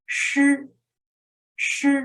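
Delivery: shi with the tongue curled back; teacher demonstrating shi; retroflex shi. Shown as a demonstration. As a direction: model shi clearly for the class. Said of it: "number nineteen is shi".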